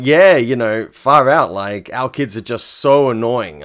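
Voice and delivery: loud, playful mock enthusiasm; excited banter. Date playing along with the fake-couple banter, excitedly. Date's reply voiced in banter.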